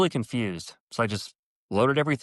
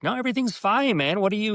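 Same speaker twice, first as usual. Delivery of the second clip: much higher-pitched imitation of himself insisting; retold anxious denial; 11 semitones higher than usual. Nephew assuring his uncle everything's fine, retold high-pitched denial. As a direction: brush off the worry in a high, hurried-to-reassure voice.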